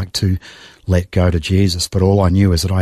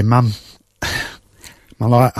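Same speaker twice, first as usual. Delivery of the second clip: emotional; 3 semitones higher than usual. Real, not acted. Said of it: "still get emotional all these years later".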